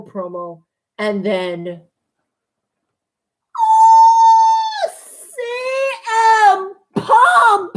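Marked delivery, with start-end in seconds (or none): 3.6-7.7 shrieked oh, much higher and louder; ecstatic shriek. Real, not acted. Fan screaming as CM Punk appears on the show. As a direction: scream in disbelief.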